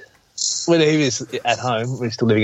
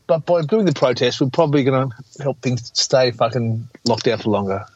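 normal talking voice

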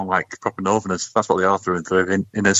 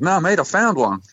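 higher-pitched, lightly acted reply; lightly voiced joke character correcting his friend; joke punchline; 9 semitones higher than usual. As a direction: answer as the mate, voice pitched up. Punchline in the mate's voice.

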